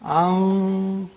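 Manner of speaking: "An-" held on a hidden nasal n; ikhfa. Shown as a demonstration. Teacher isolating the syllable, long hidden n in "An-". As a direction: hum out the n of "An-" without the tongue tip. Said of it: "because it is ikhfa".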